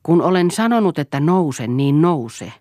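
angry husband ordering wife out of bed; furious command. Acted. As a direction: bark the order at her. Drunk husband repeats command furiously.

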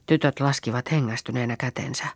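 ordinary delivery, nothing marked

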